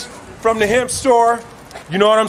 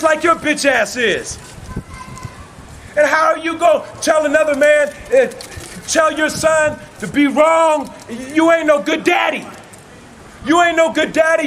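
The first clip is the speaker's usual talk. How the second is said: shouted, pitched-up sermon cadence, hammering each line; fiery street preaching; 5 semitones higher than usual. Acted. Preacher railing at hateful people, shouting a sermon. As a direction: preach it at full force, hammering each repeated phrase. Preaching rant against hatred.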